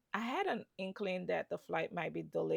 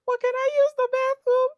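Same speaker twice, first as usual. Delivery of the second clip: loud and much higher, laughing through; 15 dB louder than usual. Retold or imitated. Asking agent, laughing.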